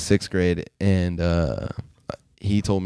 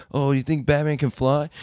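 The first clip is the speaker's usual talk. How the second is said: put-on taunting kid; 7 semitones higher than usual. Bully kid taunting in story.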